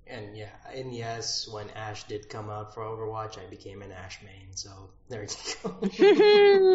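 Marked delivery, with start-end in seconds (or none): 5.6-6.7 high, slow, laughing it out. Real, not acted. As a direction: laugh through the words. Laughing about becoming Ash main.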